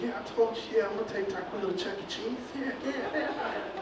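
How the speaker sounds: hushed urgent whisper. Acted. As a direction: whisper to keep quiet. Whispering to the female.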